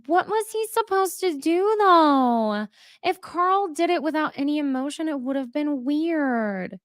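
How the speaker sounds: lightly mimicking a castmate defending him; mocking Amanda. Host mocks Amanda defending Carl, high whiny mimicry. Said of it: "Amanda says".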